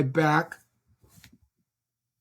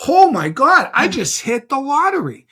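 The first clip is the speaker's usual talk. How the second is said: higher, louder retold elation; excited; 6 semitones higher, 5 dB louder than usual. Agent reliving his luck at landing big buyers, excited. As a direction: exclaim it like a jackpot win.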